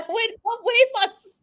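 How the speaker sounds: high, cracking disbelief with a near-cry; startled confusion. Retold or imitated. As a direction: thrown by a stranger's new accent. Shocked by cousin's twang on phone.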